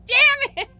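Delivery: loud, high, laughing curse; laughing frustration. Real, not acted.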